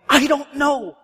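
high-pitched, lightly hammed-up admission; breezy cluelessness. Pastor looking at a math problem, high mock cluelessness. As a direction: lift it high, as if happily stumped. Acts out being stumped by math.

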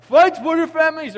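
high, loud Scottish battle cry, badly faked; bad Scottish accent. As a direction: bellow a Braveheart rally in a botched Scottish accent. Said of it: "the Scottish accent".

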